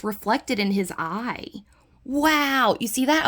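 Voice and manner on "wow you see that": loud, high burst of amazement; amazed. Real, not acted. Showing viewers a reflection in the dog's eye, amazed. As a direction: exclaim in raised, excited surprise.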